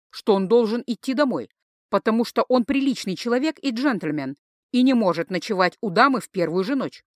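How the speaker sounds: ordinary delivery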